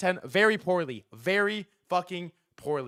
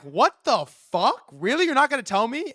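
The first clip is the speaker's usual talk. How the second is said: incredulous; 7 semitones higher, 3 dB louder than usual. Retold or imitated. Retold outrage at Chris.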